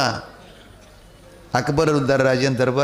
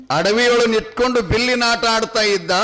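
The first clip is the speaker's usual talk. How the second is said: high, ringing folk-tale recitation; high-pitched, sustained narrator's chant; declamatory storytelling; 9 semitones higher, 4 dB louder than usual. A narrator's reading. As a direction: declaim it high, stretching the phrase endings.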